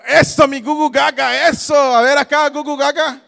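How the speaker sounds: high, playful cheering on; shouted goo-goo talk; loud baby talk. Pretend. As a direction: egg on the pretend babies. Cheers on people playing babies.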